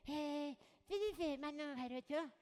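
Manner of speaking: put-on quiet, hesitant boy asking; shy boy mumble. Shy big-eared boy asks.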